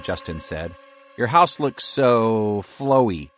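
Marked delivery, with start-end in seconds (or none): 1.2-3.3 slow amused. A narrator's reading. Justin drawn-out voice.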